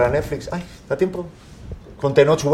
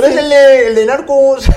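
excited recognition; 10 semitones higher, 9 dB louder, slower than usual. Retold or imitated. Retells recognizing Tenoch excitedly.

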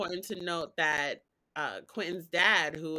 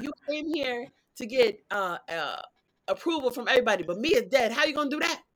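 mock furious accusation; 6 semitones higher, 6 dB louder than usual. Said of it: "He was like".

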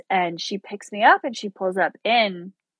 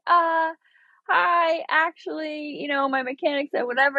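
high, sheepish hi; squeaky awkward apology to the seller; awkward sheepishness; 7 semitones higher than usual. Retold or imitated. Awkwardly returning the borrowed car.

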